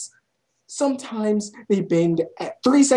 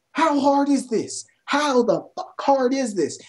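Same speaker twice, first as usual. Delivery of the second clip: ordinary delivery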